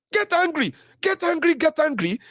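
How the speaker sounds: high, insistent exhortation; emphatic urging. Real, not acted. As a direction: hammer the command home. Urging listeners, repeated high.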